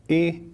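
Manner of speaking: ای as a short e, one beat; short e vowel sound. Shown as a demonstration. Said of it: "صدای کسره". (صدای کوتاه کسره)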